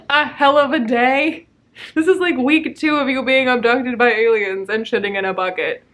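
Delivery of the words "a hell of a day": incredulous. Real, not acted. Incredulous echo of quote.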